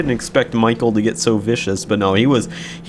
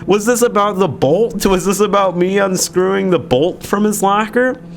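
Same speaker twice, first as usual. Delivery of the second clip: incredulous; 10 semitones higher than usual. Retold or imitated. Baffled retort after being tackled.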